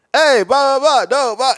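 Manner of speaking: high, loud hyped-up Ben impression; mock Ben barging in, bursting with hype; imitating Ben. S0 mimics friend Ben chatting people up, high and loud. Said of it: "Ben just comes in like".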